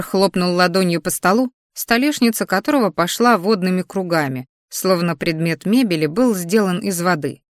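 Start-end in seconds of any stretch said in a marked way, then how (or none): none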